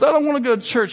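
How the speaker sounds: high-pitched mock kid refusal; acted son, pitched well up; protesting son. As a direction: repeat the protest like a stubborn child. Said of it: "He goes".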